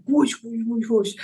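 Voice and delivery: whooshing movie effect, breathy; mock whoosh sounds. Shown as a demonstration. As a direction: swish it like a movie fight scene. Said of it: "Not like The Matrix".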